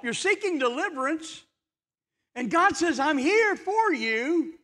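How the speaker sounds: high, earnest pulpit voice; preaching. Acted. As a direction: press it home to the congregation.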